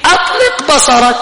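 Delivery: high-pitched mock urging; mock invitation. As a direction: pitch it up as a sarcastic go-ahead. Preacher mockingly voices permissive advice.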